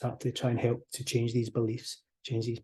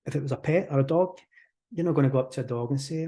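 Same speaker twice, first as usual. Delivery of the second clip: ordinary talk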